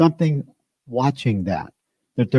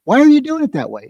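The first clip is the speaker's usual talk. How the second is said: higher, fast, exasperated demand; exasperated boss; 11 semitones higher, much faster than usual. Retold or imitated. Carpenter boss snapping at a clumsy helper, higher and fast. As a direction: snap at the worker who botched it. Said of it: "even the tone of my voice when I said".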